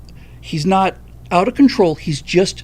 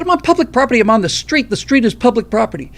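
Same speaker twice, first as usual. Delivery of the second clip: much higher, loud swearing rant; furious shouting; 4 semitones higher, 4 dB louder than usual. Retold or imitated. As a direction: yell it at the stranger, fed up. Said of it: "I dropped all the F-bombs because I was so fucking pissed off".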